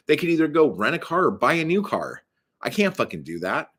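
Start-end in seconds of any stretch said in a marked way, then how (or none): none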